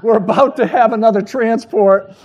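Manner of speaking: loud, laughing pretend dispatch call; laughing mock radio call. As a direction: joke calling for backup, cracking up. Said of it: "felt like going".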